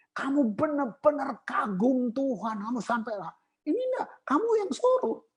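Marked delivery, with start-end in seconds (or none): 3.6-5.2 mock high accusation. Mocking the leader who commands.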